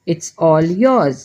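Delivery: very slow, every word laid out clearly; slow model phrase. Shown as a demonstration. Teacher slowly models an English apology for Hindi learners. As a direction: say it slowly so learners can repeat it. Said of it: "तो आप कहेंगे".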